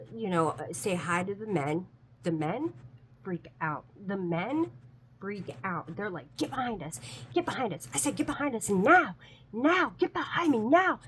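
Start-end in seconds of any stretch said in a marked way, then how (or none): 6.4-11.0 urgent raised-voice order, higher and louder; mock screaming. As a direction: build to loud, high barked commands. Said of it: "screaming in front of these".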